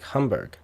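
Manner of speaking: "Hamburg" with an open "ah", as hahm-boorg; "Hamburg" with first vowel like "hum"; German pronunciation. Retold or imitated. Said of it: "you actually got it right".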